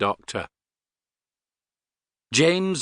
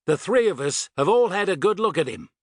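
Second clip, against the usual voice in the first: high, forceful insistence; insistent shout; 7 semitones higher than usual. A narrator's reading. Tony convincing Astrid by radio, shouting. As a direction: push it up high, emphatic. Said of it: "he shouted into his radio".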